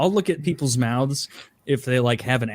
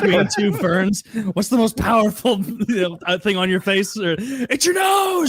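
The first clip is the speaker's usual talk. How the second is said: high-pitched Southern impression; Zach Galifianakis; 7 semitones higher than usual. Cast member quoting Between Two Ferns, Zach Galifianakis impression. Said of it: "that's the, the Zach Galifianakis".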